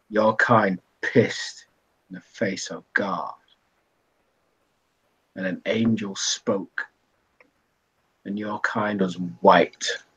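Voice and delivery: low, very slow pronouncement with heavy pauses; low, measured, ominous storyteller; grave, cryptic bartender. Acted. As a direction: deliver a dark verdict slowly, voice sunk low. Plays Ashwood delivering a grave warning.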